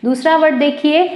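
ordinary talk, nothing marked

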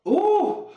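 sharp high strain sound; high strained ooh. Real, not acted. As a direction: let out a high pained ooh. Strain mid-set.

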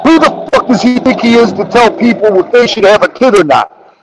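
imitating an outraged friend; quoting a friend going off; mock rant. Friend ranting about Cody's post.

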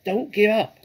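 high, loud, urgent plea; panted. A narrator's reading. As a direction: urge them on at high pitch. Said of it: "panted Mister Fox".

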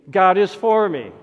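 normal talking voice

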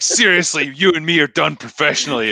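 mock-serious telling-off in imitation.

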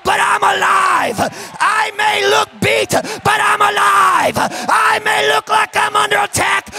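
high, loud revival cry, slow held endings; rallying shouted preaching. Real, not acted. Preacher urging families to get up and fight, full shouted preaching. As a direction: shout the whole church onto its feet.

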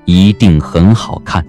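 normal talking voice

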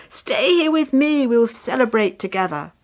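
loud, elated invitation; overjoyed father, high then easing down; very happy. A narrator's reading. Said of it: "His father was very happy".

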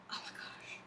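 breathless whispered oh my gosh; whispered gush over a collectible. Real, not acted. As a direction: hush it like a secret delight. Whispering excitement over pins.

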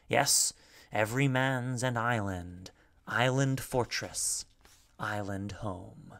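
slow, measured verse reading with lingering line ends; poem recitation. A narrator's reading. Narrator recites B's poem about breathing in space, slow measured recitation. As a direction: draw the verse out, letting each line ring.

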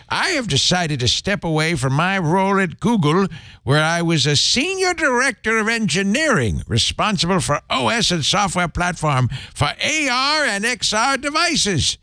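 lightly voiced cranky old man; a hint of a grumpy old man. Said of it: "I'm gonna do him in a cranky old man ro-, voice".

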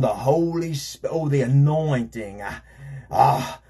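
hammed-up revival emphasis; mock Pentecostal preacher. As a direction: imitate a preacher leaning on holy words. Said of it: "They even gotta put that little extra".

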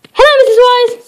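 high, chirpy greeting; Mister Rush. Acted. Mister Rush greeting Mister Surprise, high and chirpy. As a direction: sing out hello at the door.

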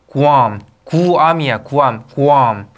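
Guam drawn out as 구암, "goo-AHM"; correct pronunciation of Guam. Shown as a demonstration. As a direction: drill Guam slowly, opening it into gu-am. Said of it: "이렇게 읽으셔야지".

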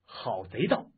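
firm angry challenge, voiced without a full shout. Yang to the Taoist as he draws his dagger, an angry shout. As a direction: denounce him with a forceful finish. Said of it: "杨铁心喝道".

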